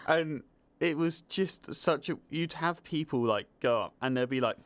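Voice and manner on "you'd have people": normal talking voice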